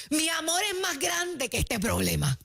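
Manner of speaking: mock shout. Shown as a demonstration. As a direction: declare love as if shouting mid-quarrel. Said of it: "el único grito en medio de una pelea".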